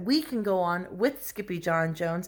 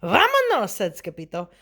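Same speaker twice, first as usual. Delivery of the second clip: high, loud kitten call; rally the gang to set off; eager rallying cry; 6 semitones higher, 6 dB louder than usual. A narrator's reading. Kitten urges friends onward.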